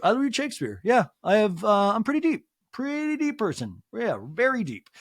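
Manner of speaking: imitating a boy trying to sound deep; mock pretentious teen. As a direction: play a kid showing off depth. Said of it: "come off deeper as a young man".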